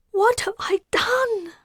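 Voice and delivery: high, breathless, whispered despair; sobbing whisper. A narrator's reading. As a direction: whisper it between sobs. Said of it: "began sobbing again".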